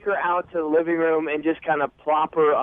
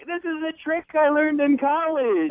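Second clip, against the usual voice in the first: higher mock-proud quote; imitating drunk girl; 8 semitones higher than usual. Man quoting his date about her party trick, lightly imitating her. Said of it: "And she's like".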